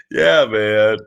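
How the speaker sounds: ordinary talk, nothing marked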